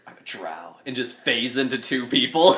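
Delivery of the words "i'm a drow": low, very soft in-character line; quiet declaration. Acted. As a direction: say it low and under your breath. Acting his character's quiet announcement.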